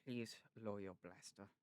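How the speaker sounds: low German-accented narrator impression; slow, measured German accent; soft German accent. Shown as a demonstration. Imitating the narrator for a friend, soft German accent. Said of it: "So German".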